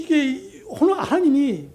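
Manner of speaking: usual speaking voice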